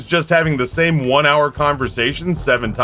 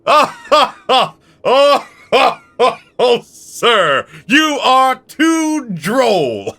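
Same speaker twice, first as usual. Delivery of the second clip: high, slow fake-laughing waiter; a hammy posh waiter's chortle; 9 semitones higher, 3 dB louder, much slower than usual. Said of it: "did you have to go like".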